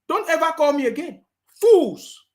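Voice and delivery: loud, high angry outburst; angry shout. Real, not acted. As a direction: yell it at the caller. Hanging up on caller.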